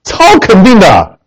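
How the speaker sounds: ordinary delivery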